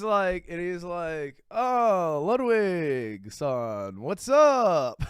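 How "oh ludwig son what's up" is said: drawn-out greeting, swelling at the end; exaggerated impression of a teacher caught out; imitating Japanese teacher. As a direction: mimic the teacher's stretched-out hello. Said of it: "I'm gonna show you exactly what it's like".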